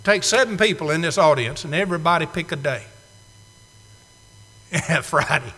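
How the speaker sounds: usual speaking voice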